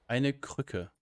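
usual speaking voice

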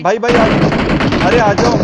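loud, urgent call to teammates. Real, not acted. Player hurries squadmates over mid-game, calling out loudly. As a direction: shout for your squad to come over.

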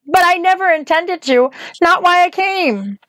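put-on high, slowing girl's voice; young Dorothy. Dorothy insists to the witch, high girlish voice.

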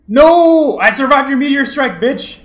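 high, loud gloating shout; triumphant yell. Real, not acted. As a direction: scream it in triumph.

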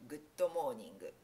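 Good morning read slowly as gudo moningu, extra o and u; slow romaji-style Good morning with an added u after the g; katakana reading. Shown as a demonstration. Teacher repeating the wrong romaji reading, slowly. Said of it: "ローマ字読みをしてしまうと".

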